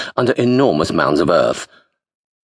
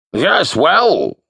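put-on high, loud old wizard boom; 12 semitones higher, 3 dB louder than usual. A narrator's reading. Wizard greeting Bingo, booming and loud. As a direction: project like a doddering wizard. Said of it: "said the wizard in a booming voice".

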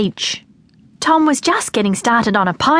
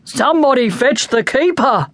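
mild narrated shout, slightly raised; 3 semitones higher than usual. A narrator's reading. Dad calls for the zoo keeper, a restrained shout. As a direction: call out for help, not full volume. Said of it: "Dad shouted".

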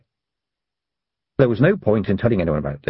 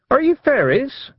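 put-on high girlish call across the water. A narrator's reading. Tiffany calling after the little men, high girlish call. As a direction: call out to the boat like a young girl. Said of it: "Tiffany shouted".

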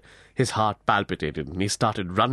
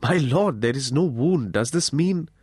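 higher-pitched, hopeful amazement; amazed and happy; 5 semitones higher than usual. A narrator's reading. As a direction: ask in mild, happy wonder, pitch lifting. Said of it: "amazed and immensely happy".

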